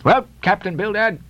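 gruffly prodding captain's voice; Peleg interrupting. Acted. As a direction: cut in on your partner. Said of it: "interrupted Peleg".

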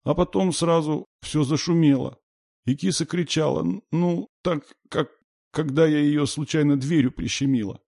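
put-on very high, plaintive child; high, halting, upset child's voice; little girl near tears. A narrator's reading. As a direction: stumble through it, nearly crying. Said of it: "Девочка едва не плакала".

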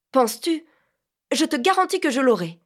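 high, loud and eager; defiant excitement. Acted. Vinca to Phil, refusing to stop hunting, high and eager. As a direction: brush him off, sure of the catch.

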